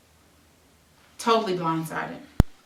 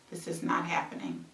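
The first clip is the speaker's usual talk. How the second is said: much softer, trailing away; fading whisper-quiet; 9 dB quieter than usual. Real, not acted. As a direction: let it fade to almost nothing. Repeating in disbelief, fading.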